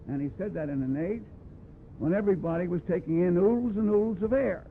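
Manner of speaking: usual speaking voice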